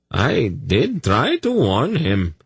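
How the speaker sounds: high, slow, nervous excuse. A narrator's reading. Zadi defending himself to the king, nervous. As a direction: excuse yourself timidly in a high voice. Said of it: "Zadi replied nervously".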